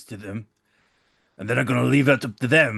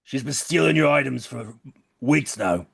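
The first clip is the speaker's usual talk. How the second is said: slow, raised public announcement; oratory; 4 semitones higher, slower than usual. Acted. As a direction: address the whole bar grandly. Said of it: "please do oratory now".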